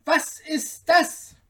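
high, drawn-out tailor voice; little tailor, high and amazed; tailor's astonished cry. A narrator's reading. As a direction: exclaim slowly in high-pitched amazement. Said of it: "rief der Schneider".

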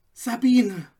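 put-on young man calling his beloved; called out. A narrator's reading. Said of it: "rief er".

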